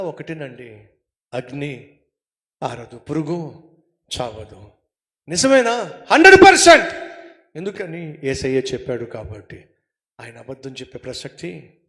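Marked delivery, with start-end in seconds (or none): 5.3-6.9 sudden loud burst on the answer; emphatic shout. Acted. Preacher to congregation, suddenly loud emphatic answer. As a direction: bang out the answer as certainty.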